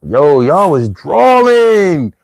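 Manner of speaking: very slow Philly drawl; drawn-out Philly accent. Shown as a demonstration. Demonstrating Philly talk for viewers, dragged-out Philly accent. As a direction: drag the last word out long, Philly style. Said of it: "drag my words out a lot".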